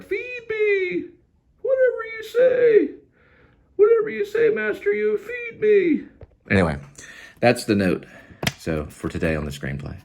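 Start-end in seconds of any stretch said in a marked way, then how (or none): 0.0-6.1 cat voice. Pretend. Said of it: "He's like".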